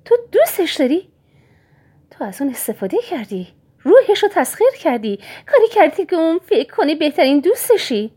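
high, loud, wounded indignation; mother, insulted by the villain, hurls her accusation high and loud; insulted outrage. Acted. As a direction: fire it back as if deeply insulted. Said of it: "با لحنی که گویی با او اهانت شده است گفت".

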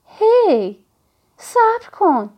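high-pitched urgent "wait!" call. Acted. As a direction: call after someone walking off. Calling after someone walking away. (با صدای بلند و زیر صدا زدن)

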